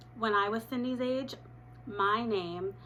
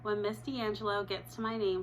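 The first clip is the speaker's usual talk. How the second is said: ordinary delivery, nothing marked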